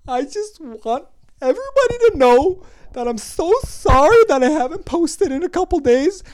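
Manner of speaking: high, overwrought mocking voice; apologetic Instagram blogger. Mocking bloggers who apologise to followers, exaggerated tearful plea. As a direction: ham up a pleading apology at high pitch. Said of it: "and they're just like".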